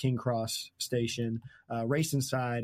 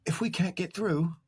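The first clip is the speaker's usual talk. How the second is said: lightly voiced worried boys; 7 semitones higher than usual. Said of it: "the boys realize".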